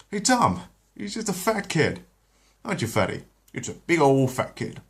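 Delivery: high, loud sneering call; a bully calling his pal over; jeering taunt. Acted. Guard jeering at Chris to his co-worker, high and loud.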